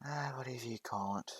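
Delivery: breathy whisper, brushing it off; dismissive whisper to the bully; whispered dismissal. Acted. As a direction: let it slip out under your breath.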